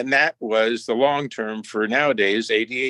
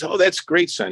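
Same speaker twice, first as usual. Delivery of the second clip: Jackie Robinson; 8 semitones higher than usual. Retold or imitated. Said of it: "He goes".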